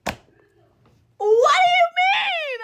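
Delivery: very high, drawn-out wailing shriek; loud, slow, high-pitched wail; shocked scream. Retold or imitated. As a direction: scream it in disbelief, stretched long. Screaming reaction to shocking news.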